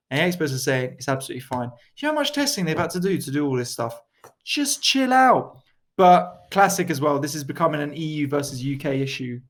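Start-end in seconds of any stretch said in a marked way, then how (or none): 4.4-5.6 slow mock chill-out. Mock expert-defender telling off.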